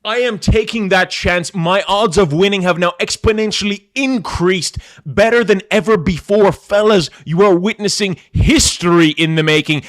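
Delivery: triumphant. Real, not acted. Triumphant boast to the chat.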